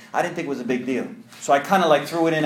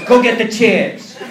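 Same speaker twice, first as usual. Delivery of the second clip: louder, higher, slower laughing order; insistent repeat; 7 semitones higher, 7 dB louder, slower than usual. Retold or imitated. Sponsor insisting over the rodeo, loud and laughing. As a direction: repeat the order, firmly and bigger. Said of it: "He goes".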